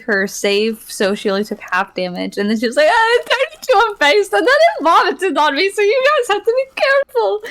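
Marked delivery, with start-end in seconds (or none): 2.9-7.4 much higher, a little louder, near tears; panicked high voice. Retold or imitated. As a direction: squeal the warning like she's about to cry. Player's character quoted warning group.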